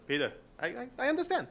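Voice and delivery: high, laughing mock rabbi, fast at the end; rabbi's voice. Rabbi with arm on his shoulder, high and laughing. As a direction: play the cheery rabbi who won't take no. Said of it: "Puts his arm on my shoulder".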